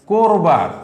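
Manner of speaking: করবার said "kor-bar", first vowel o, not a; teacher demo of করবার with an o sound; rounded first vowel. Shown as a demonstration. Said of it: "করবার না বলে আমরা বলবো".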